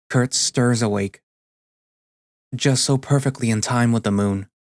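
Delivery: ordinary delivery, nothing marked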